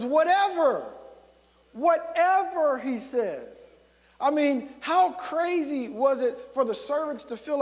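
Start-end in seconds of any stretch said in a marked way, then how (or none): 0.0-3.7 "Whatever" punched high and loud; stress on whatever. Shown as a demonstration. Preacher highlighting the key phrase, "whatever" loudly stressed. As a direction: lean hard on "whatever", slowing the repeat. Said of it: "the thing that jumps out even about that phrase is".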